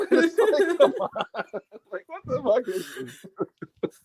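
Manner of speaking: high, laughing disbelief, slowing at the end. Real, not acted. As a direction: laugh through it, pitch up. Laughing at reality show lines.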